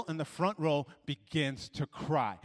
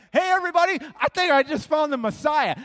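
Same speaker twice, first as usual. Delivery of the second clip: high, loud shout to a whole town; acted excited announcement, pitched way up; excited calling out; 12 semitones higher, 11 dB louder than usual. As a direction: holler the big news to a crowd.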